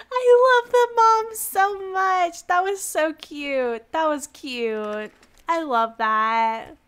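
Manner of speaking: drawn-out, slow cooing delight; adoring gush. Real, not acted. Gushing over the cute mom.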